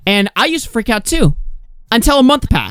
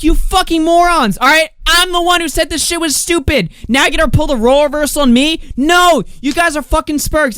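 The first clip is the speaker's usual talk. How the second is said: retold rant; 10 semitones higher than usual. Retelling own rant.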